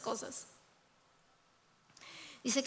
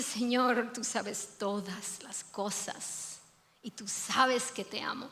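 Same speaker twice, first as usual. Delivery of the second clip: tearful confession; 4 semitones lower, 5 dB quieter, slower than usual. Acted. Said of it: "se le llenan los ojos de lágrimas y dice".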